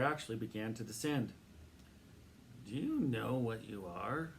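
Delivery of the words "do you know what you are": slow, drowsy question; sleepy. A narrator's reading. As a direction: ask it half asleep. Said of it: "the man said sleepily".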